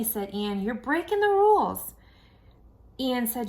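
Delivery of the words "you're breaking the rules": high, louder tattling whine; whiny scolding kid. Acted. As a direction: scold her like a prissy little brother. Ian scolding sister.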